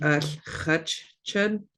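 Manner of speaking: ordinary talk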